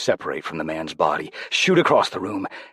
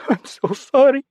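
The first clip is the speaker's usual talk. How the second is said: much higher, much louder wailing apology; crying; 13 dB louder than usual. Acted. Rodrigo weeping to Jose after the exorcism, crying apology. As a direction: sob the words out. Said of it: "he cried".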